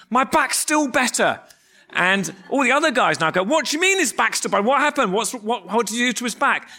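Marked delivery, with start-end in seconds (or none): 0.1-1.4 high, slow called-out announcement; imitated yell. Teammate yelling his healing news across the field, imitated yell. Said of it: "he yells, he goes". 3.4-6.7 high-pitched, tumbling mock disbelief; astonished questioning. Teammates demanding to know what happened, imitated astonishment.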